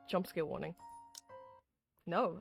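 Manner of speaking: ordinary delivery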